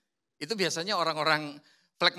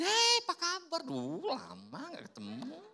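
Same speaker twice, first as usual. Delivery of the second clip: friendly usher; 10 semitones higher, slower than usual. Acted. Sanguine usher greeting a long-absent churchgoer, bright and welcoming. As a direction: welcome an old friend warmly.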